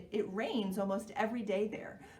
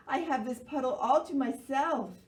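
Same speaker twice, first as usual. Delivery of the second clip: happy frog; 5 semitones higher, 6 dB louder than usual. Acted. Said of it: "Froggy croaks happily".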